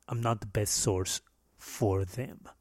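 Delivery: usual speaking voice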